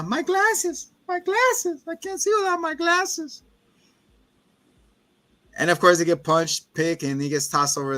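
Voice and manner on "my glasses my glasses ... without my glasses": much higher, whiny helpless voice; mock Velma lost without her glasses. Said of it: "like, Velma".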